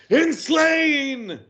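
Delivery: high, hyped-up wrestler yell; shout. Acted. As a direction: scream it to the crowd. Wrestler hypes crowd on mic.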